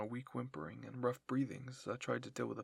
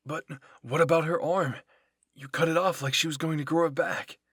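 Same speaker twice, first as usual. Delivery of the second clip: loud, higher-pitched objection; alarmed protest; 4 semitones higher, 12 dB louder than usual. A narrator's reading. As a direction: argue back loudly, appalled. Comrade argues over severed arm.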